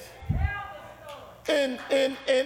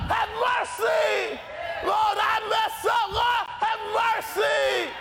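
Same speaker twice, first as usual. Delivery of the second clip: crying out for mercy; 6 semitones higher than usual. Retold or imitated. Blind Bartimaeus calling to the passing Jesus, desperate yelling. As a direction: beg at full voice like a blind beggar calling out. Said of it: "he started yelling out".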